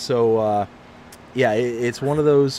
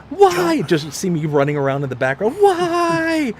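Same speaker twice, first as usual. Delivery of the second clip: high frantic mock scream of why; 11 semitones higher, 4 dB louder than usual. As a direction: shriek it in hammed-up panic. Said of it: "I'd probably be screaming".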